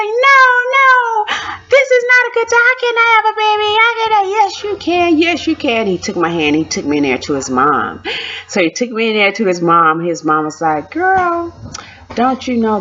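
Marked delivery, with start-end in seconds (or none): 0.0-4.3 very high-pitched wail; reenacted tearful refusal, rushing upward; distraught protest. Acted tearful protest to husband. 4.3-5.9 high-pitched imitated reassurance; reenacted husband urging her on; insistent husband. 10.9-12.8 high, drawn exclamation; imitated knowing elder's opener; mother-in-law.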